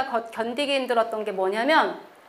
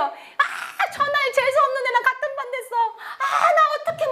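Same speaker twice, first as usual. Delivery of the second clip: much higher mock-despairing schoolgirl wail with a laugh; whining bully; 13 semitones higher than usual. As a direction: fake a dramatic groan of disgust. Bully whines about same class. (높은 목소리로 과장되게 투덜대는 여학생)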